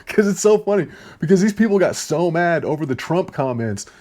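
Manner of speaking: laughing through the words; giggling while talking. Real, not acted. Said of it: "I can't even say that without laughing".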